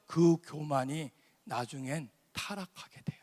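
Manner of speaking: hushed whisper; whispered warning. Acted. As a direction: drop to a whisper for weight. (속삭이듯 낮게 경고하는 소리)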